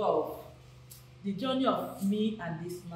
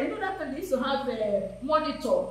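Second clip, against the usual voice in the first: ordinary delivery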